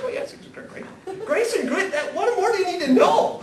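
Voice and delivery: high, laughing bluff ending in a shout; giggling through a loud fake endorsement; laughing mock confidence. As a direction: crack up while faking certainty. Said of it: "I say it with confidence".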